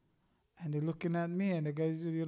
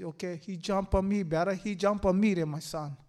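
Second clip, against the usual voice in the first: louder, firm declaration of resolve; defiant retort; 3 semitones higher, 8 dB louder than usual. Retold or imitated. Father defying the evil to protect his son, loud and resolute. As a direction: stand firm and say it out loud to the Lord. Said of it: "I said".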